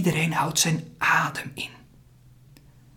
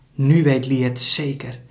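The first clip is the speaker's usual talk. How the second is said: plain reading voice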